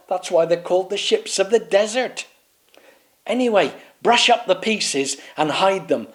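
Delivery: lecture, then command; pompous knight. Acted. Swaggeralot ordering the knights to hide pieces, high and bossy.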